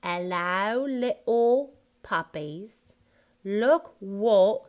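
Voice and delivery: "hello little" as ellao li'ul; loud, slow WHAT without its t; Cockney dropped sounds. Shown as a demonstration. Coach building Horace's line, Cockney dropped sounds. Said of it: "drop that T".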